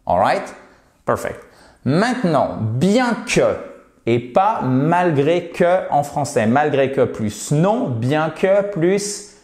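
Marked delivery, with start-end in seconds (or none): none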